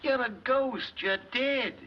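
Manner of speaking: high, frightened yelp; panicked. Acted. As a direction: gasp it out in sudden fright. Husband sees a ghost.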